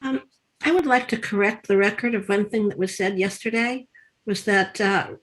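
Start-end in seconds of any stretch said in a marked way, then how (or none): none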